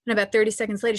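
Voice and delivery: ordinary talk, nothing marked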